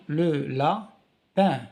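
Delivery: le lapin, drawn out slowly; slow teaching stress. Shown as a demonstration. Teacher stretching le lapin slowly for pupils.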